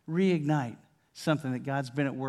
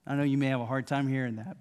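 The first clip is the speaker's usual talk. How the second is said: usual speaking voice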